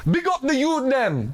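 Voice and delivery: high, loud hype shout; passionate. Shown as a demonstration. Coach showing his trainee the phrase, passionate high shout. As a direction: yell it out with passion. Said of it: "say it with passion".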